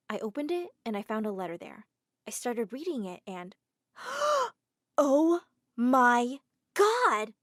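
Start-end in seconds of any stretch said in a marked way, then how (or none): 4.0-4.5 sharp high gasp; shocked gasp. Acted. Girl gasping at the letter. 5.0-7.3 slow, loud anguished cry; horrified cry. Acted. Girl crying out after reading the letter.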